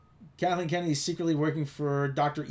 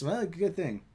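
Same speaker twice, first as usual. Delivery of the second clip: ordinary talk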